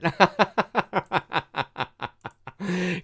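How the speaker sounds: long amused laugh; a real laugh at himself. Real, not acted. Laughs at his own slip.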